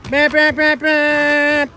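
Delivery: loud, high hype yell; excited shouting. Real, not acted. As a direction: yell it as he lands. Cheering a trick landing.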